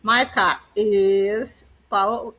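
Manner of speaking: very slow, each subject and is drawn out; teacher pointing out the verb to be very slowly. Shown as a demonstration. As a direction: stretch out each is to show the verb. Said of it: "o verbo to be".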